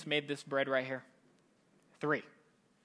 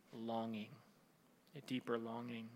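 much lower and softer; hushed reflection; 6 semitones lower, 13 dB quieter than usual. Real, not acted. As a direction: let it sink in, almost under the breath. Preacher drops voice for reflection.

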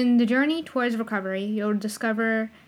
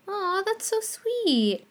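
touched; 10 semitones higher, much slower than usual. Real, not acted. Touched reaction to horoscope.

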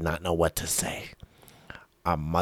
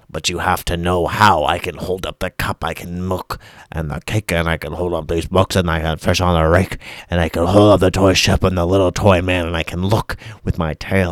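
building boast; 9 dB louder than usual. Acted.

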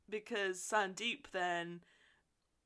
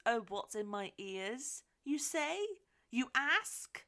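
mock showing off. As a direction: play a mother flaunting her earrings. Mother showing off earrings, acted.

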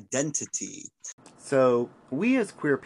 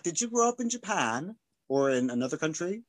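ordinary delivery

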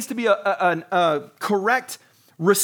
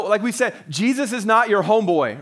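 ordinary talk

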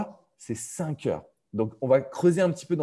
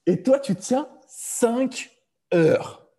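drawn-out, much higher cry of amazement; amazed; 12 semitones higher, 6 dB louder, much slower than usual. Real, not acted. Host marvelling at the guest's five-hour plank, amazed and drawn out. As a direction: stretch it out in wide-eyed disbelief.

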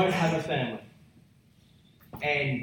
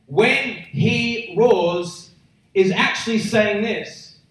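louder, higher, slowly drawn-out lead-in; raised, measured pulpit build; building proclamation; 5 semitones higher, 6 dB louder, much slower than usual. Real, not acted. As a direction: preach it up big, word by word. Preacher building to the lion's declaration.

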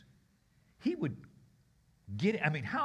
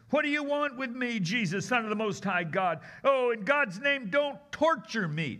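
frantic demon plea; 6 semitones higher than usual. Acted. Possessed man crying out to Jesus, higher and pleading. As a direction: beg him not to torture you.

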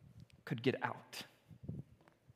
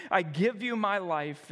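usual speaking voice

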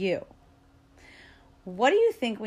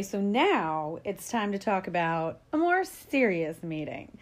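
ordinary talk

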